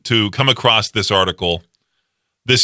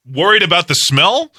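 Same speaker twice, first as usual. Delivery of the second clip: ordinary talk, nothing marked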